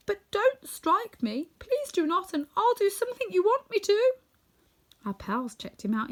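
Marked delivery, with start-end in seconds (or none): 0.1-4.1 high-pitched frightened pleading; trembling voice. A narrator's reading. Little man begs not to be struck, high and fearful. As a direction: beg for mercy in a raised, high voice. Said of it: "in a trembling voice".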